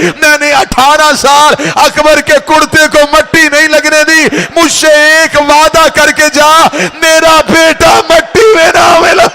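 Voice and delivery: high, loud, crying preacher's howl; wailing lament. Acted. Preacher voicing Zainab's plea to Hussain, loud crying wail. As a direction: cry out the mother's plea.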